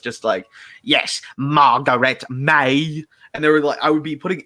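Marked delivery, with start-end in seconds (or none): 0.8-3.0 very slow villain voice, each word leaned on; slow, over-emphatic monster delivery; Staticus. Shown as a demonstration. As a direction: drag out every word with odd, heavy emphasis. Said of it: "take after take of Staticus".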